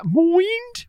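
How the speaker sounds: high-pitched Brummie "Mind"; exaggerated Birmingham vowel on "Mind", very high; Birmingham accent. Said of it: "accent from the".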